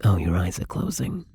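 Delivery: ordinary delivery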